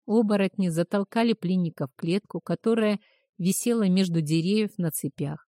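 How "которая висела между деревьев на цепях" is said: ordinary delivery, nothing marked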